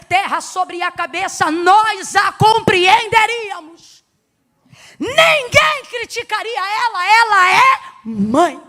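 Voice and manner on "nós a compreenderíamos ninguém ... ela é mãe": loud, slow, stretched sermon cry; full-force preaching. Real, not acted. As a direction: proclaim it loudly, dragging out each word. (pregação em alta voz, arrastada)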